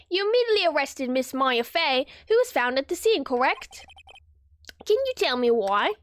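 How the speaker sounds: formal British prosecutor; British accent. Acted. Prosecutor questions the arresting detective, British accent. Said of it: "forgot how to do a British accent".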